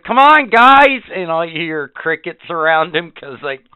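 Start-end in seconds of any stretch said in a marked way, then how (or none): 0.0-1.0 higher, louder, slow call to nobody; hammed-up call to an empty room; mock plea. Lone guy calling his absent group, mock plea.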